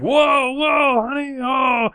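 high startled yells of whoa; dad yelps as pee splashes his feet; alarmed shout. Retold or imitated. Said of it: "And he's like".

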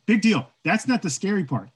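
usual speaking voice

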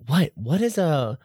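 high-pitched baffled questions, hammed up; incredulous, pitch leaping up on the questions; mock bewilderment. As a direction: play a totally lost reader. Acting out confused readers.

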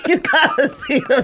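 high voice breaking into laughter; laughing. Real, not acted. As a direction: talk through the giggles. Laughing retelling fight video.

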